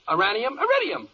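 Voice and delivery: high, loud cheerleader chant of element names; hyped-up pep-rally yelling; football yell. Acted. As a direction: rattle off the list like a stadium cheer. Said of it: "are these football yells necessary".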